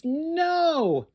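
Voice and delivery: plain reading voice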